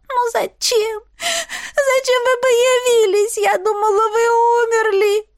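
high, drawn-out tearful wail; bitter sobbing. A narrator's reading. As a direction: wail through sobs in a high voice. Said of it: "Девушка горько зарыдала".

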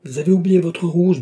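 high, loud scolding; narrated father's loud reproach; crying out. A narrator's reading. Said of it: "cria-t-il".

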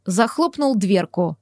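plain reading voice

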